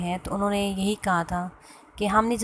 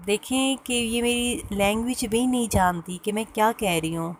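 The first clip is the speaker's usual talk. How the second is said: ordinary talk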